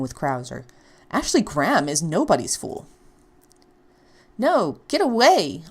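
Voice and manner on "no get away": louder, slow, pleading get away; frightened plea. Acted. Ashley fending off the stranger, scared. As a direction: push him off in fear. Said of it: "huddled against a barrel in fear".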